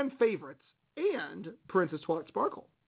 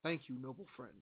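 quiet; 6 semitones lower, 16 dB quieter, faster than usual. Pretend. Softly addressing the pretend falcon.

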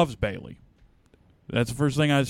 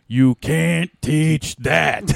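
loud, high, hugely drawn-out delivery; hammed-up catchphrase imitation; wrestling catchphrase; 4 semitones higher, 8 dB louder, much slower than usual. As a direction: stretch each word like a ringside boast. Quoting a wrestling catchphrase for laughs.

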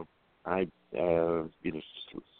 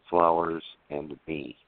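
normal talking voice

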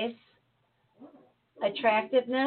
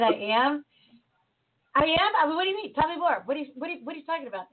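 imitating her own startled reply, higher pitched; surprised; 5 semitones higher, much faster than usual. [name] startled at being called rude, mock surprise. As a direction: retell it as a taken-aback, higher, rapid protest.

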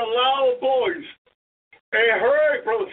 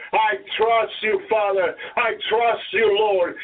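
heartfelt prayer; 4 semitones higher than usual. Acted. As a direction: start trusting, rise into begging. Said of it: "to say those words from your heart to God".

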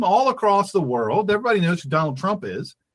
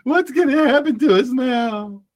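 higher, whiny mock fear; a hammed-up cry of alarm; mock panic; 6 semitones higher, slower than usual. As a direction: imitate frightened people wailing. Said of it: "They're like".

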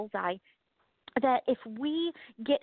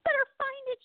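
much higher, playful mocking sing-song; sing-song taunt; 13 semitones higher than usual. Co-host's laughter mocking Laura for losing her train of thought, high sing-song taunt. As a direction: tease her like a kid on the playground. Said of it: "That laughter was like".

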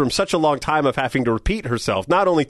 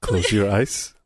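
a low, intimate croon for Lisa; sexy voice; 6 semitones lower, 5 dB quieter than usual. Pretend. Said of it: "that's not the voice you use with your wife".

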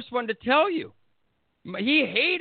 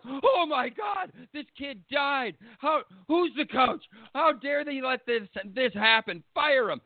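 mock outrage; 4 semitones higher than usual. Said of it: "society was like".

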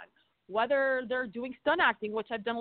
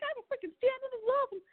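mock thrilled. Retold thrilled thought on set.

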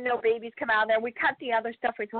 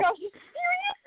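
very high, squeaky are you serious; mock disbelief. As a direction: go way up in shock. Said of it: "and I was like".

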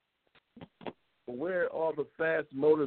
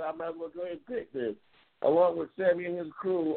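usual speaking voice

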